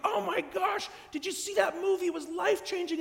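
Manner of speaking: high, breathless mock gushing; gushing excitement. Calling a friend about a life-changing movie, gushing. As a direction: rave about the movie on the phone. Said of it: "you're like".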